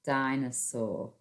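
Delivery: dinosaur said slowly; teacher dictates while writing; slow dictation. Shown as a demonstration. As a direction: say dinosaur slowly as you write. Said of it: "find the word dinosaur".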